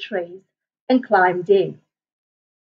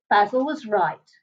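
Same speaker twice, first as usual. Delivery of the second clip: ordinary delivery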